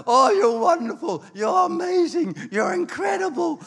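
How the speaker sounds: high-pitched, hammed-up flattery; mock gushing praise. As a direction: gush over him like an adoring pastor. Imitating pastor flattering him as teenager.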